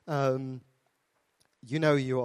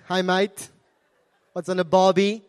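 hammed-up Aussie greeting, slightly lower; Australian accent; 3 semitones lower, 3 dB louder than usual. South African greeting customs as an Aussie, mock Australian accent. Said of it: "I try and be Australian".

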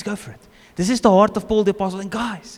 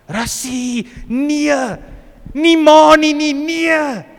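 high, loud drawn-out protest; wailing rugby fan; 7 semitones higher, 5 dB louder, much slower than usual. Acted. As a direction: wail at the coach like an exasperated fan.

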